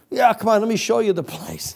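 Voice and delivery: high-pitched, keen acted offer; eager invitation. Tipsy guard invites new pal to the plant, eager. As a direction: show off to a new buddy.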